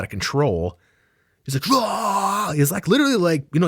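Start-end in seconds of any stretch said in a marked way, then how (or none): 1.7-2.5 sudden high battle-cry roar; Braveheart yell. Retold or imitated. Comic telling the crowd about a gym maniac, imitating his high battle yell. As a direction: mimic a gym maniac yelling at a heavy bag. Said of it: "like, Braveheart yelling".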